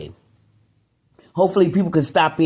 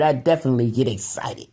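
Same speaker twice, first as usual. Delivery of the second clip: ordinary talk, nothing marked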